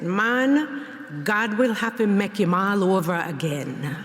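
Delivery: an elder's dry Jamaican patois retort; Jamaican patois accent. Retold or imitated. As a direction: quote grandma in her Jamaican patois. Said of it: "in her thick Jamaican patois accent".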